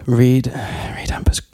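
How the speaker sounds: deep, breathy trailer whisper; sexy deep voice. Pretend. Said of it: "What happened to the sexy deep voice".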